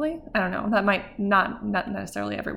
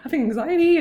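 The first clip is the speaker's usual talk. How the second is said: higher, louder, rushed panic; fast, breathless retold panic; re-living the panic of rushing to the hospital; panicked; 7 semitones higher, 4 dB louder, faster than usual. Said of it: "I was so, like, jittery and antsy".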